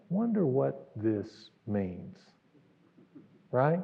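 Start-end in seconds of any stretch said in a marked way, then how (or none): 0.1-2.2 slow, soft musing under the breath; wondering to himself. Retold or imitated. Peter wondering alone on the empty night sea, slow and hushed. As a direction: let the doubt creep in quietly. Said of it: "he begins to think".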